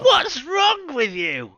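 slow, very high and loud, laughing; drawn-out callback, squealing in mock outrage; mock indignation. Host repeating the catchphrase as a joke, slow loud mock outrage. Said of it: "callback to the takes".

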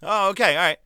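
ordinary talk, nothing marked

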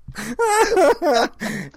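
high squealing laugh. Real, not acted. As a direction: break out laughing. Laughing at the joke.

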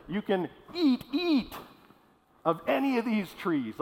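"eat, eat" with the second eat flung up high; doubled word emphasis. Shown as a demonstration. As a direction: lean hard on the repeated eat. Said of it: "the Hebrews had a way of emphasizing".

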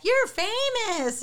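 high, drawn-out mock yell; imitated kid's yell. Young boy spotting Zach in town, high mock yell. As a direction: call it out like an excited young boy. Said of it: "one of the young boys yells at Zach".

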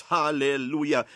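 ordinary delivery

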